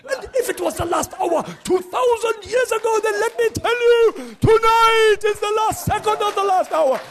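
high-pitched revival shout, building in volume; evangelist's preaching impression. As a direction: imitate a fiery evangelist at full force. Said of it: "did one of his messages".

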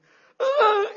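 sharp, high, loud gasp of shock. Acted. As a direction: gasp as if seeing the leprous hand. Moses sees leprous hand.

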